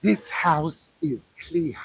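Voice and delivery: slow, low, eerie pronouncement; movie medium line. Retold or imitated. Quoting Poltergeist at the Scientology building, slow and eerie. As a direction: intone it like a spooky medium.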